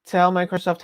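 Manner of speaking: ordinary talk, nothing marked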